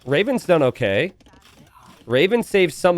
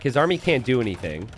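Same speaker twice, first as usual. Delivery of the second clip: normal talking voice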